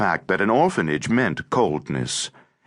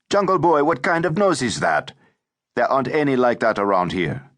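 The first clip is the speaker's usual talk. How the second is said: put-on higher-pitched, needling boy's voice; brash teasing boy; 5 semitones higher than usual. A narrator's reading. Ramazan mocks the new boy's nose, brash and teasing. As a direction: tease the newcomer, starting high and settling.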